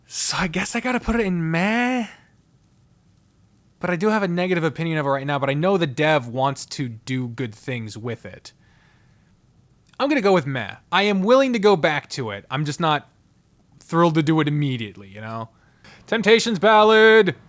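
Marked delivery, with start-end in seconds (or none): none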